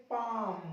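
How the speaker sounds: long "aa" straight into M in पाम; silent L. Shown as a demonstration. Teacher showing students how palm reads, पाम said "paam" without L. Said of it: "इसको पढ़ेंगे".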